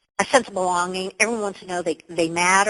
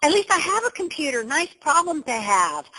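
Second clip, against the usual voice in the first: squealed high yay, then cheerful; delighted yay; 8 semitones higher than usual. Pretend. Speaker telling the call how she reframes problems, high delighted yay. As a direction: turn a computer problem into a cheer. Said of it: "my other thing is saying, like".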